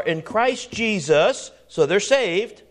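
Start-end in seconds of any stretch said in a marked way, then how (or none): none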